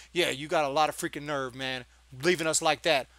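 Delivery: usual speaking voice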